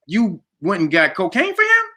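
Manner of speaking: high, incredulous retold question; outraged disbelief. Friend confronting Bo over the joint, incredulous. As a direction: challenge him, pitch lifted in disbelief.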